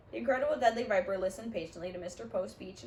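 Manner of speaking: ordinary delivery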